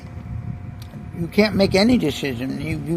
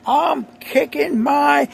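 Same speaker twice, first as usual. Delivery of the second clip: higher, slow comic line breaking off; hammed-up giddy movie character, cut off by laughing; Jim Carrey manic; 8 semitones higher, slower than usual. Retelling Liar Liar bathroom scene, mock manic comic voice. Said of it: "Jim Carrey's, he's banging his head".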